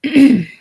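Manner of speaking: sharp, loud throat clearing cough. Acted. Ailing Nightingale clears his throat before speaking, loud rasp. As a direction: clear the throat hard, like a sick man. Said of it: "He coughs".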